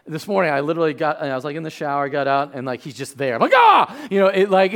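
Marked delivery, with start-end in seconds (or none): 3.6-4.1 high, loud startled scream; terrified yelp. Retold or imitated. Dad finds his son just there after the shower, startled scream. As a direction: yelp as if ambushed. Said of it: "it's sort of terrifying".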